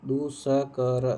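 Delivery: दुष्कर drawn out very slowly, each syllable clear; दुष्कर stretched out slowly for students; slow spelling-out demo. Shown as a demonstration. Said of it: "दुष्कर लिखते हैं ऐसे".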